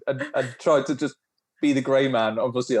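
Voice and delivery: ordinary talk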